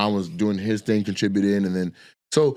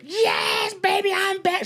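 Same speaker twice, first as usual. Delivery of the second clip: high-pitched imitation of her excited yell; excited screaming; slower than usual. Imitating host greeting the cameras, excited screaming. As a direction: squeal it like an overexcited host on stage. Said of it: "She's screaming and yelling".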